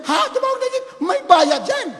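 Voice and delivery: high, loud disbelieving echo; squawked objection, voice leaping up; incredulous protest. Acted. Wife objects to paying for a jeep, incredulous.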